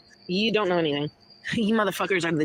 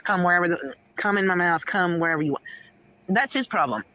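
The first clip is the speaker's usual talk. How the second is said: ordinary talk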